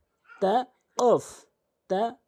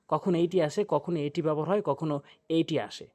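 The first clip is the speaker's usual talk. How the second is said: ordinary delivery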